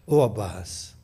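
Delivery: a broken hush over a dying brother; hushed grief. Acted. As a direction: call him gently as he fades.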